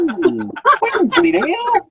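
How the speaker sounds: very high cartoon impression; comic high-pitched teasing question; Wallace and Gromit. Teasing a mate with a high cartoon voice. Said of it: "Like Wallace and Gromit".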